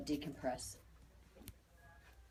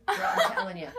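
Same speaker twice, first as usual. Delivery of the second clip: much higher, laughing excitement; excited squeal; 8 dB louder than usual. Real, not acted. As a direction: burst out laughing with delight. Excited about master healer reading.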